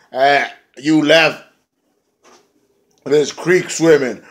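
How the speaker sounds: a hint of a twangy Tennessee brawler. James Storm's redneck promo.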